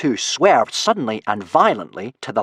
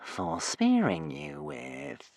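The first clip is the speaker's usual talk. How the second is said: whining serpent; 3 semitones higher, 9 dB quieter, much slower than usual. A narrator's reading. Serpent whines at trapped Hiccup, slow and menacing. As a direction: drag it out like a sly beast. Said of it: "whined the nearest polar serpent".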